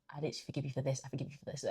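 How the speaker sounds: very soft, hushed prayer; quiet forgiveness prayer. Retold or imitated. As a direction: murmur it like a private prayer. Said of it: "So that day I was literally like".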